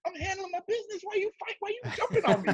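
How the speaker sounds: mock high squeaky whine. Retelling how he defended himself to his friend, in a mock high whine. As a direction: play yourself as a squeaky indignant brawler. Retold self, voice pitched up.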